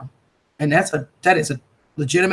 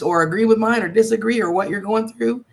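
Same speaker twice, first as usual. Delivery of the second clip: ordinary talk, nothing marked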